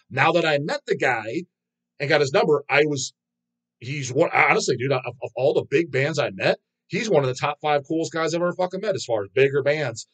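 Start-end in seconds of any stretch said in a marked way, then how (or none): none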